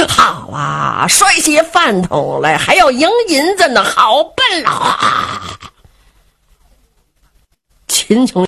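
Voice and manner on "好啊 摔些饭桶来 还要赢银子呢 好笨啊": drawn-out mocking crowd call; jeering onlookers. Spectators mocking the thrown fighter, drawn-out jeering. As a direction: stretch the jeer out, slow and sing-song. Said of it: "这看热闹的就嚷上了".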